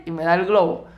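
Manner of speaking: usual speaking voice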